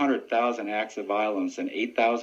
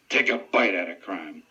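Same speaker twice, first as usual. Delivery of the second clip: McGruff; 3 semitones lower than usual. Retold or imitated. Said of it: "the man behind McGruff that says".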